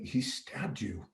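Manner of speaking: quietly voicing the friend's shock; hushed warning. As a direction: drop to a hushed, grave telling. Friend tells him he's stabbed.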